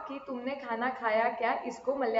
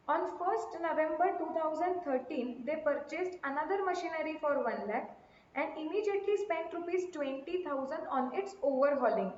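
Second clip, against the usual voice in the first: slow, soft dictation of a problem; reading a question; 4 dB quieter, much slower than usual. Acted. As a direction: read the sum out slowly for class. Reads out the question. (धीमी आवाज़ में प्रश्न पढ़ना)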